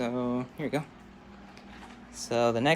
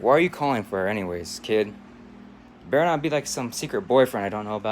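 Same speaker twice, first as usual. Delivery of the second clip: lower, loud interrogation; suspicious dad; 3 semitones lower, 4 dB louder than usual. Acted. Father questioning a boy phoning his daughter, suspicious. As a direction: grill the kid in a stern father voice. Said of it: "and the parents answer".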